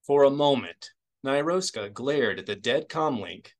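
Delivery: plain reading voice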